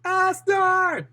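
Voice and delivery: very high-pitched cries for help; frightened cries. Acted. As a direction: call out desperately, way up high. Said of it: "And he said".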